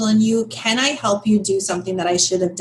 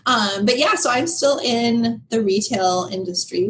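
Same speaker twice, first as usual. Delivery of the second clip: ordinary talk, nothing marked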